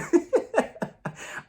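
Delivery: airy giggle, way up; high breathy laugh. Real, not acted. Vlogger laughing at her own joke, airy high giggle.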